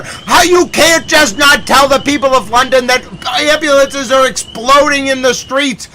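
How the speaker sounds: loud, high, shocked outcry at the news; alarmed shouting. Real, not acted. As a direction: yell it out, truly alarmed and indignant. Streamer shocked by breaking footage.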